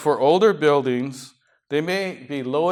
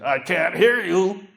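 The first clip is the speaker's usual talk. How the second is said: imitated heckler, higher-pitched voice; lady calling from back; 4 semitones higher than usual. Lady in the back interrupts pastor's grace, mock calling out. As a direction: call out higher, as if from the back row. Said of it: "a lady in the back said".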